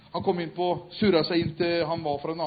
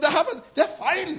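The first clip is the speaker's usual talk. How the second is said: indignant cry; 9 dB louder than usual. Acted. Preacher voicing inner protest.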